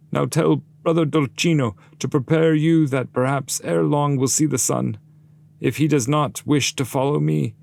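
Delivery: high, loud voice of a damned soul; Mohammed's warning. Mohammed sends urgent warning to Dolcino, high loud voice.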